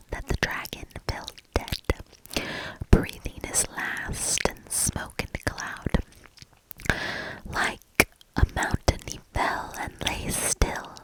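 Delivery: ordinary delivery, nothing marked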